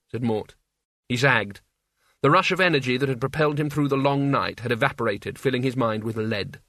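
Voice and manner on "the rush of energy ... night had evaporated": ordinary delivery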